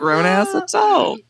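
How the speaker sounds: laughing. Real, not acted. Laughing at childish joke.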